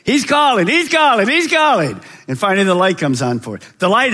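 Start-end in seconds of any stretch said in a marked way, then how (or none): 0.0-2.0 rising, louder calls of he's calling. Acted. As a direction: call it out three times, building. Preacher calling out repeatedly.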